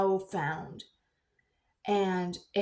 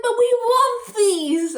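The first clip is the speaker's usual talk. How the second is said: high, loud, slow whine; whiny kids; 9 dB louder, slower than usual. Retold or imitated. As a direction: drag it out like a pleading child. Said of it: "They're like".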